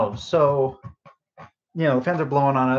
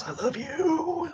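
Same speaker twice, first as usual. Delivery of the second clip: mock sweet; 6 dB quieter, much slower than usual. Apologizing jokingly to her boss.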